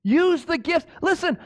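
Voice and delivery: full-throated sermon climax; preaching at full force. Acted. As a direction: drive the point home to the congregation.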